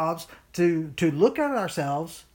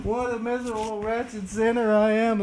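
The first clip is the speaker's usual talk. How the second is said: mildly hammed-up wail of guilt, a bit higher; mock self-reproach; 5 semitones higher than usual. Preacher imitating believers after they fall, mock self-reproach. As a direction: imitate someone wallowing in their own wretchedness. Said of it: "We fall and we're like".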